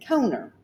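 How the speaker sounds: 'corner' said 'COH-ner', without the first R; dad's regional pronunciation. Retold or imitated. Daughter mimicking Florida dad, 'corner' as 'coner'. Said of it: "my dad was from a".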